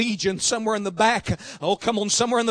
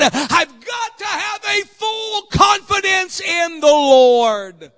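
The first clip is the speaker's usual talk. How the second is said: raised, driving preacher's voice building to loud shouts; impassioned preaching; 4 semitones higher, 7 dB louder than usual. Real, not acted. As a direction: press it home, then stretch out the climax. Preaches with rising force.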